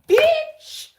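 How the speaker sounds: punchline squealed very high and loud; playful shrieked kicker; sassy squeal. Real, not acted. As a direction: fling the last word up in a shriek. Punchline of affirmation.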